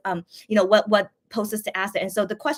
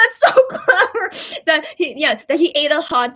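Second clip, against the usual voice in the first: laughing praise; 5 semitones higher, 7 dB louder, slower than usual. Retold or imitated. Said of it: "I was like".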